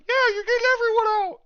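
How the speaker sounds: high-pitched, fast shout from a prisoner; prisoner's yell. Acted. Game master voices a prisoner calling to the rescuers, yelling. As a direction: yell it out high and loud, rushed, like an excited captive. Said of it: "one of the prisoners yells out".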